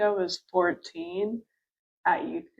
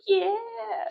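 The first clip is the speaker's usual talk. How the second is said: excited cheer; 13 semitones higher than usual. Retold or imitated. Kids cheering broken rules.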